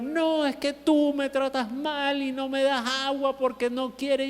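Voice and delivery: a pastor mimicking a sobbing, complaining child; high, whiny child voice; crying child. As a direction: lightly play a wailing boy accusing the adult. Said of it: "comenzó a llorar".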